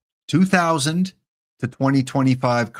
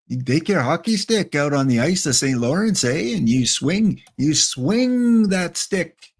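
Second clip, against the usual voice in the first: mock Canadian hockey guy; 4 semitones higher than usual. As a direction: play a hockey fan from the Saint Lawrence. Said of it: "Now I'm sounding like a Slapshot".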